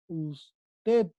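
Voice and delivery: "Usted" split slowly into us and ted; syllable by syllable. Shown as a demonstration. Teacher showing the stressed syllable, word split in two. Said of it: "Aquí está su sílaba tónica".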